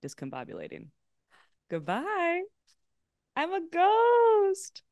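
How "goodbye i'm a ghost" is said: high, drawn-out spooky ghost voice. Pretend. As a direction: wail it like a ghost. Pretending to vanish on call.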